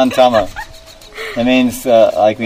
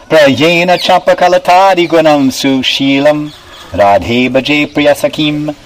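recited Sanskrit verse, slightly raised; verse recitation; 3 semitones higher than usual. Acted. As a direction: intone the verse to the goddess.

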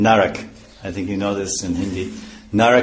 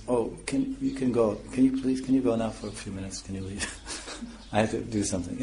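much softer, gentle pleading request; soft begging tone; 8 dB quieter than usual. Shown as a demonstration. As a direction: coax someone politely out of the room. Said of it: "if the husband says".

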